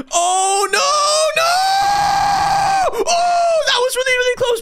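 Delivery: high frantic yell; panicked scream. Real, not acted. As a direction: scream as it barely misses. Narrow escape from plane.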